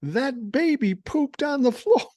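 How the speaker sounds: high-pitched imitation of a kid blurting out; screaming little kid. Kid announcing to Mommy across the restaurant, high mock scream. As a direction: mimic a small child calling out, drawn out. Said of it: "The kid screams out".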